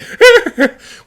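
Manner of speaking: high, loud frightened whimpering; mock-terrified squeals while fleeing; panicked running noise. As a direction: yelp as you run for it. Sailor running from dragon.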